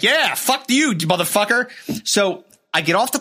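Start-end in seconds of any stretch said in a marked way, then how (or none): 0.0-1.7 higher mock jeering at the old man; lightly voiced rowdy passengers; cheering crowd. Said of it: "And everyone's like".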